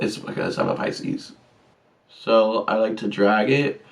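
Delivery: normal talking voice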